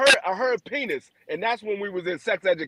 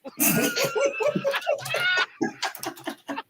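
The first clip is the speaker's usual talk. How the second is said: long high-pitched cackle; high laugh; 5 dB quieter than usual. Real, not acted. Laughing at her grandson's line, high-pitched. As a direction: squeal with laughter.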